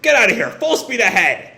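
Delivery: loud, high rallying shout; urgent calling. Retold or imitated. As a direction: shout orders to a fleeing crew. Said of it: "Ibaraki calls".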